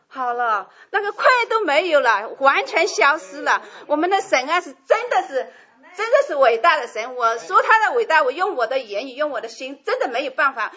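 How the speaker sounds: praising God through tears, loud and overcome; tearful praise. Real, not acted. Testifying to the church about healing, loud tearful praise.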